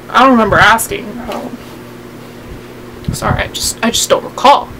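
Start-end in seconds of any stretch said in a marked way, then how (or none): none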